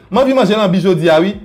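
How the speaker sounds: normal talking voice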